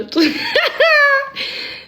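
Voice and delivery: long high-pitched laugh near tears; high squealing laugh. Real, not acted. Laughing at her blunder on the live, high squealing. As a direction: laugh till you nearly cry.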